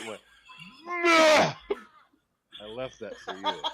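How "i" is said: loud, high mock retch; sudden comic barfing noise; imitated dog retch. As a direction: heave like a dog being sick.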